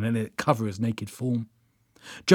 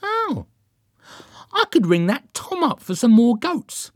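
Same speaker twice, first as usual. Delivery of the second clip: high, eager, louder pipe-up; bright suggestion; 12 semitones higher, 5 dB louder than usual. A narrator's reading. Derek offers to ring Tom for goats, bright suggestion. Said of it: "he suggested brightly".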